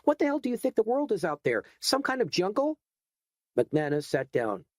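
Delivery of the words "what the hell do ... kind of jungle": much higher, building outrage; angry rant. Acted. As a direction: tear into him, rising all the way. Edgar rants at the hoodlum.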